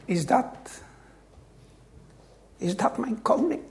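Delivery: very soft, halting question; hushed, with a pause after the first words. Acted. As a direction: ask it almost under your breath. Preacher quotes a song in awe.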